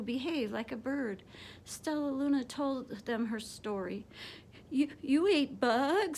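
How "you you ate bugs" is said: mild stunned stammer, slowed down; a touch of a shocked stutter. A narrator's reading. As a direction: stumble over the first you in disbelief. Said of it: "stuttered one".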